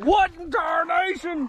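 high, loud mock-outraged voice; imagined startled dog. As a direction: voice the dog's shock in a hammed-up high yell. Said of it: "She's gonna be like".

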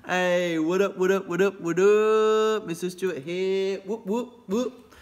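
high, loud, hyped-up shout-out; hyped greeting. Real, not acted. Teacher hyping up his students, loud excited greeting. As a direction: pump up the class with the greeting. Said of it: "I'm just too excited".

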